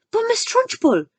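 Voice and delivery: very high alarmed cry; shocked protest. Acted. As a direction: gasp out her objection. Miss Honey protests.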